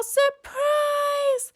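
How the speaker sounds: high, loud party shout; excited yell of surprise. Acted. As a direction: shout it like friends jumping out. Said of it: "His friends yell".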